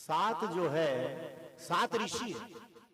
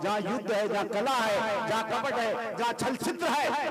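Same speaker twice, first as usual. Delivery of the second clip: each vice hammered louder; God firmly naming what he will not abide; emphatic listing; 3 semitones higher, 5 dB louder than usual. Acted. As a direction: build the list with rising force.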